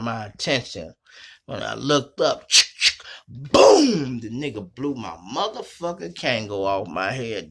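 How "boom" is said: loud mouth-made gunshot; a punchy shouted boom. Retold or imitated. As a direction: gunshot sound. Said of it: "imitated gunshot".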